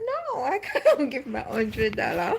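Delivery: high, laughing no; laughing refusal. Retold or imitated. To the Holy Spirit over the offering, a laughing refusal. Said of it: "And I said".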